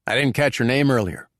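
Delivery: lightly voiced American diner; a hint of an American dinner guest. A narrator's reading. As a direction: strike up table talk as an American guest. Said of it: "said one of the Americans".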